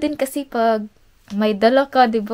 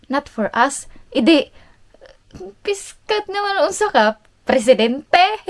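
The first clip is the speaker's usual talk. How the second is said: mock indignant retelling; 6 semitones higher, 5 dB louder, slower than usual. Mocking husband's dismissive reply.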